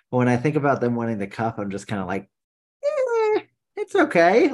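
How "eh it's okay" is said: high, lilting "eh, it's okay"; tossed-off high-pitched shrug; high mock shrug. Quoted own lukewarm feeling.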